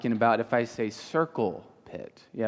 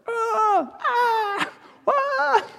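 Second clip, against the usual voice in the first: high-pitched mock screams in bursts; roller-coaster scream; much slower than usual.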